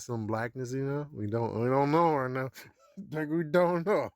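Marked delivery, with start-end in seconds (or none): none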